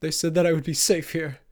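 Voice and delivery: high, loud frightened complaint; whimpering. A narrator's reading. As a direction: whimper it in rising distress. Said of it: "Marcus whimpered".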